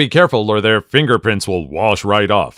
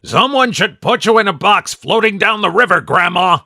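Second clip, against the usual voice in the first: Plankton voice; 9 semitones higher than usual. Retold or imitated. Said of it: "Plankton insulting the whole family".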